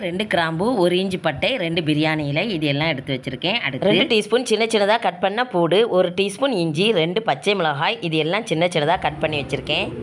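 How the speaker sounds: normal talking voice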